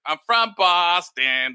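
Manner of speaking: slow, drawn-out Boston pitchman imitation; Boston commercial guy. Imitating the guy in Sam Adams commercials, Boston pitchman voice. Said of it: "those commercials of the guy who's like".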